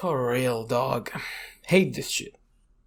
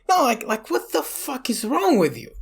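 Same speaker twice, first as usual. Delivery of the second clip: pitched way up in disbelief; incredulous, what is wrong with you; outraged disbelief; 11 semitones higher than usual. Real, not acted. Telling a friend about the mechanic, high incredulous outrage.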